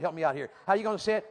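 ordinary delivery

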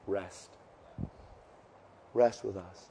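very soft, low and unhurried; gentle reassurance. Retold or imitated. Paul to people under persecution, hushed gentle comfort. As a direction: soothe them with long pauses. Voicing Paul's comforting message to sufferers.

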